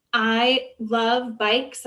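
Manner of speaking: ordinary talk, nothing marked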